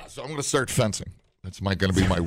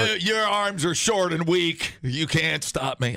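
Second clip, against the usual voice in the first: slow, higher hammed-up taunt; lightly played duelist sneering; mock trash talk; 6 semitones higher, slower than usual. Taunting an imagined fencing opponent, slow mock sneer. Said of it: "what you say when you go to a fencing".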